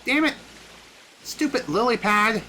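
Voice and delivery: high, sharp cursing at the game; frustrated outburst. Real, not acted. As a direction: snap at the lily pad. Lily pad vanishes, he drowns.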